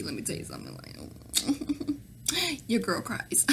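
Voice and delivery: hushed, almost breathless admission; whispered with a giggle; whispered confession. Real, not acted. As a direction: lean in and whisper it with a laugh. Confessing she cries.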